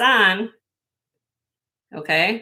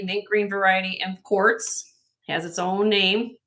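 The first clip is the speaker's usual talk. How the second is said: ordinary talk, nothing marked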